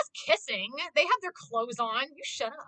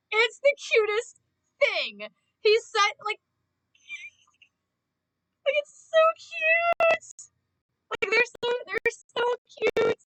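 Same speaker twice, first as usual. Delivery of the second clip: much higher, louder, slow fangirl squeal; gushing squeal; 12 semitones higher, 7 dB louder, slower than usual. Real, not acted. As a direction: squeal with delight, laughing. Gushing over cute scene.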